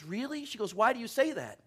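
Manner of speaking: lightly voiced wife, caught off guard; mock-surprised wife. Wife surprised by husband's remark.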